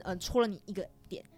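usual speaking voice

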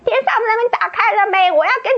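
声音高、略响的兴奋呼喊; 重现向天使天军高喊的样子; 模仿大吼. Retold or imitated. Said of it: "一直大吼大吼". (向天使天军兴奋地高声呼喊)

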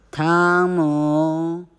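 loud, long call of the name; 大声喊汤姆. Acted. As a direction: call the boy across the house. Aunt calling louder.

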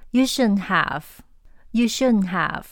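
you shouldn't have, slow, shouldn't said without the T. Shown as a demonstration. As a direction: drop the T in shouldn't, slowly. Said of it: "注意发音，shouldn't没有t". (shouldn't不发t音，慢慢读)

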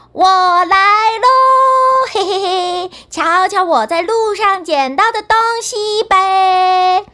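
拖长音唱着喊我来喽; 得意地高声拉长调子; 高声喊唱. A narrator's reading. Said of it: "笨蛋汉斯喊着". (高声拖腔喊唱)

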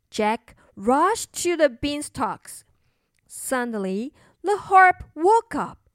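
ordinary delivery, nothing marked

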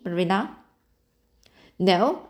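usual speaking voice

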